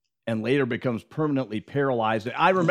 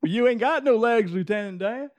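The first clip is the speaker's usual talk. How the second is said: lightly voiced Forrest Gump, higher; Forrest Gump impression; 9 semitones higher than usual. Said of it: "Magic leg".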